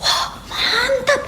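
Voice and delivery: delighted praise. Acted. Mother thrilled her son practises piano, loud and laughing. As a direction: gush over him proudly.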